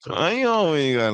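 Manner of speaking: high singsong street voice; Kanan. Retold or imitated. Mimicking a TV character who sings when talking, high singsong. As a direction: sing the challenge with mouth barely open. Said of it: "he sings when he's talking".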